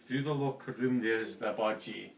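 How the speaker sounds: ordinary delivery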